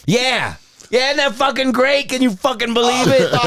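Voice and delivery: very high, amazed retold exclamation; thrilled phone hype. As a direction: hype up the caller as if starstruck. Friend hyping the fake star call.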